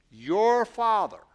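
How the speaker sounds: Your hit hard and loud, slow; stress on your. Shown as a demonstration. Pastor pointing out the play on words, stressing Your. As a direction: punch the word Your, then father. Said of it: "notice the play on words".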